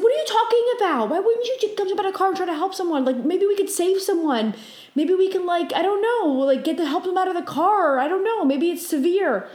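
high, urgent retold protest; freaking out. Dreamer urging the driver to help crash victims, agitated. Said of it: "freaking out thing".

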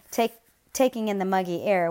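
usual speaking voice

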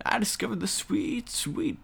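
put-on high, soft quavering old man. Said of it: "I talk in an old man voice".

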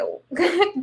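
short high laugh; breathy giggle; light laugh. Real, not acted. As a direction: laugh at the memory. Shy laugh recalling fear.

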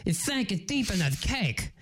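lisping cartoon duck; indignant duck impression; Daffy Duck. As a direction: scold with slushy s sounds. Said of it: "you know Daffy Duck?".

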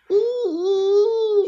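ooh. Acted. Joke high.

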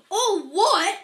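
very loud, high roar of disbelief; thundering. A narrator's reading. Enraged giant echoing the boy's question, thundering. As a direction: thunder it back in outrage. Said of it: "Hagrid thundered".

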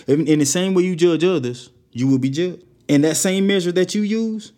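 preacher building to a rebuke; preaching. Acted. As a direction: hammer the verses home. Preaching scripture with force.

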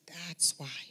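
almost whispered; a hushed word to a grieving young man. Retold or imitated. To a grieving young man at the hospital, hushed.

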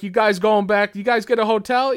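lightly imitated hearty interest; Tom Atkins impression. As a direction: quiz them like an excited pal. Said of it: "inflection is so spot on for him".

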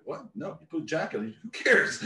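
high, laughing brush-off; amused friend dismissing it. Retold or imitated. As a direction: laugh it off like it's nothing. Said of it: "Nori's like".

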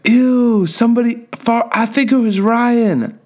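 put-on high-pitched tattling kid voice; grossed-out classmate. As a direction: mimic a disgusted child, way up high. Acted classmate reacting to fart.

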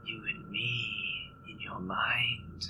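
slow eerie whisper, far off; a slow whispered demon challenge; creature whisper. Acted. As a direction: draw it out as a voice inside his head. Monster demands possession.

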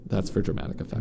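ordinary talk

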